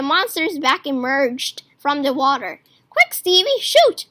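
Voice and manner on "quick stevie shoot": higher, louder urgent call to shoot; urgent shout. A narrator's reading. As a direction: yell it up high to get the shot now. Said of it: "I shouted".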